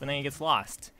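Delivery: usual speaking voice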